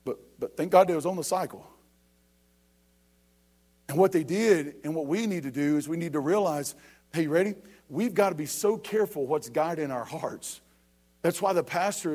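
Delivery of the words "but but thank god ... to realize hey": ordinary talk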